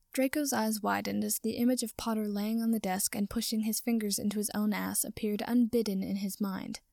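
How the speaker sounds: ordinary delivery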